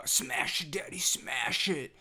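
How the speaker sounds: lightly voiced excited toddler, drawn out; playful mock toddler demand; excited little kid. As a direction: imitate a kid egging dad on.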